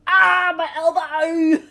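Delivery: laughing, drawn-out cry of pain; real pain cry. Real, not acted. Bumping her elbow mid-podcast, laughing cry of pain. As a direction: yelp through laughter at a knocked elbow. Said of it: "That sounded like it hurt".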